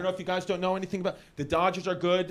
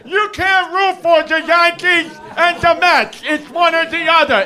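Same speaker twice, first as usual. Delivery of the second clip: loud, higher mock shout of a pushy fan; mock fan yell; 8 semitones higher, 13 dB louder than usual. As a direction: yell it like the fan who corners him. Mocking fans who yell at him.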